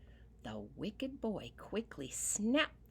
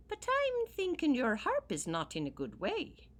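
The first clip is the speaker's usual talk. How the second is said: high, loud little-man voice; a grateful leprechaun, pitched high; 10 semitones higher, 6 dB louder than usual. Acted. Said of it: "said the leprechaun".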